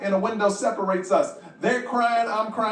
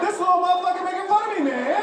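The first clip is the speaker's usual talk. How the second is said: outraged; 9 semitones higher, faster than usual. Real, not acted. Outraged at his son.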